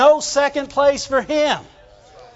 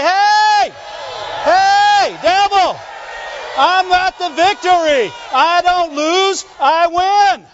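victory shout; 6 semitones higher, slower than usual. Acted. Preacher showing how to tell the devil off, triumphant shout. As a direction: shout the devil down, punching each short phrase. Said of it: "Sometimes you just need to shout".

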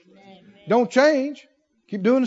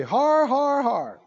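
drawn-out "Har, har" laugh; mock pirate-style laugh; defiant "Har, har"; 5 semitones higher, much slower than usual. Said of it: "he would say".

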